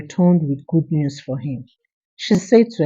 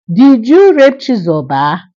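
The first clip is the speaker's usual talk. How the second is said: louder, higher shocked question; shocked outcry; 5 semitones higher, 6 dB louder than usual. Acted. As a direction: cry out in disbelief at her son. Mother shocked by the news.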